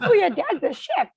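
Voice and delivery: put-on high, loud kids' voice with a laugh. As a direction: chime it like excited schoolkids. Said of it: "they were like".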